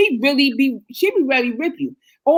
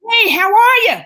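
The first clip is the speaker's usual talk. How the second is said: fake friendly greeting; 8 semitones higher, 5 dB louder than usual. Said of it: "you be like".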